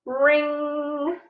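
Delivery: high, far-off imitated school bell; bell ring. School bell sound effect.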